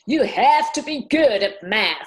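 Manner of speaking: put-on light American accent, math not maths. [name] quoting her NASA astronaut friend, American accent. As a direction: quote the astronaut in an American accent, good stressed. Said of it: "but in an American accent".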